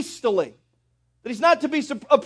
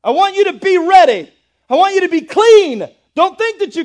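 higher, louder impassioned preaching; preaching at full force; 7 semitones higher, 8 dB louder than usual. Acted. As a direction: thunder it from the pulpit. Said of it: "preaching at full force".

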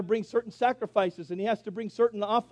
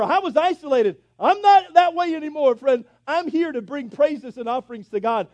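louder and higher, building to a fervent climax; preaching at full force; 9 semitones higher, 11 dB louder than usual. Acted. Preacher to the congregation, building to a loud, fervent climax. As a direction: preach it out, rising with every clause.

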